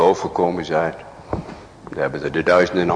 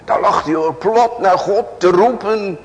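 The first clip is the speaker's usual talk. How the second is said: fervent preaching; 13 semitones higher, 5 dB louder than usual. Acted. Preaching surge.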